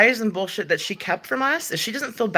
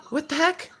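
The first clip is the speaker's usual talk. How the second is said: surprised exclamation; 10 semitones higher than usual. Real, not acted. Gasps at a chat message.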